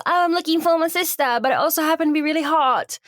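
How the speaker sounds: light-hearted imitation English accent. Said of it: "she doesn't have an English accent".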